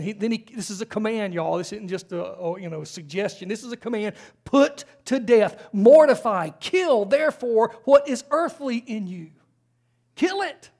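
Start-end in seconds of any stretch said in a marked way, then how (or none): 4.4-9.3 forceful command. Real, not acted. Preacher reads the command forcefully. 10.1-10.7 high, punchy exclamation; sharp command. Real, not acted. Preacher urging listeners to kill sin, high sharp cry.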